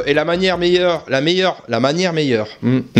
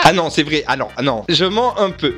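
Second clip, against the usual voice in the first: ordinary delivery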